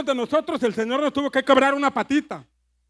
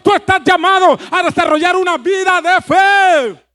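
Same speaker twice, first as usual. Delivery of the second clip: loud, building sermon cry; fiery Spanish pulpit climax; preaching at full force; 8 dB louder than usual. Real, not acted. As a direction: preach it out, louder and louder to the crowd.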